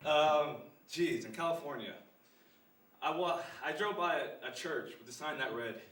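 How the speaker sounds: normal talking voice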